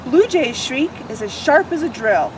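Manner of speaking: lively picture book read-aloud; expressive children's story narration. Acted. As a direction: read it like storytime for little ones.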